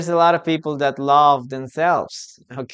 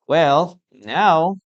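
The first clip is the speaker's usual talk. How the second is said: ordinary talk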